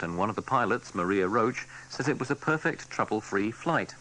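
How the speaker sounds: usual speaking voice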